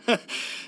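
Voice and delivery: a quiet, airless little laugh; breathy awkward laugh. Real, not acted. Uneasy laugh under his friend's stare. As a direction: let a nervous laugh slip out.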